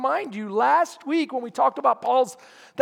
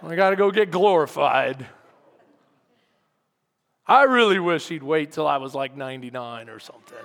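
low, glum mock complaint; reluctant killjoy, pitched way down; 8 semitones lower than usual. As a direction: grumble through it with no enthusiasm. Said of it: "like Killjoy".

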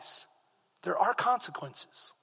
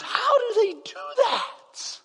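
amazed onlookers; 5 dB louder than usual. Pretend. Preacher voicing the amazed world watching believers, laughing. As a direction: gape at it like the baffled world. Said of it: "the rest of the world goes".

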